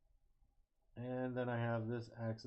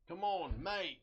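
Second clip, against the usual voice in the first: put-on higher, more urgent Australian accent; 8 semitones higher, 3 dB louder, slower than usual. Urging the stuck pin again in a higher mock Australian accent. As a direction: push it harder in a mock Australian voice. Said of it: "I feel so Australian when I do this".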